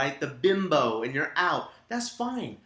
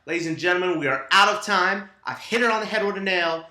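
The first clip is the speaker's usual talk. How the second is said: louder, higher announcer-like wrap-up; loud sign-off; 3 semitones higher, 7 dB louder than usual. Real, not acted. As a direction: close the show out loudly. Host signs off the show.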